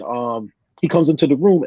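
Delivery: usual speaking voice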